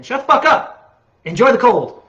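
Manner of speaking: a high, loud exasperated snap; ranting snap. Real, not acted. A ranting vlogger tells summer complainers off, high and loud. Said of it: "that's a good little rant".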